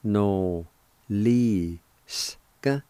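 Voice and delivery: no, li, ska with long gaps; syllable-by-syllable pronunciation. Shown as a demonstration. Teacher sounding out noliska, slow separate syllables. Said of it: "it's pronounced like this".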